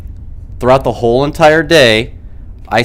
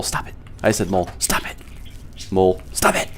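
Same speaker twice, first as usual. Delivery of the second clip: hushed scolding; 4 semitones lower, 10 dB quieter than usual. Retold or imitated.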